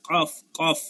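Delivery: কফ from the tongue root against the palate; tongue-root qaf. Shown as a demonstration. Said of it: "এটা হচ্ছে জিহ্বার গোড়া".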